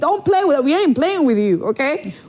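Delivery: high, lively playful hype; excited. Real, not acted. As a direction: tease the listeners with energy. Host hypes listeners playfully.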